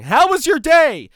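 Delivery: mock yelling a friendly question over a distance; mock scream across the street. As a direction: yell it to someone across the road. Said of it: "screaming at other people from across the street".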